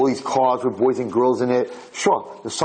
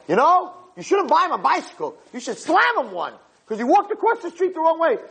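meddling scold. Acted. Meddler urging father to punish.

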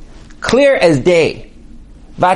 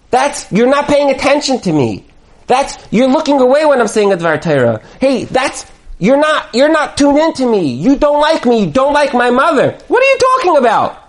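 raised-pitch acted grievance, climbing at the end; accusing complaint; 6 semitones higher than usual. Husband to inattentive wife, indignant complaint. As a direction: pile up the accusations, exasperated.

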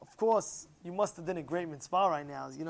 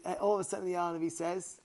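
usual speaking voice

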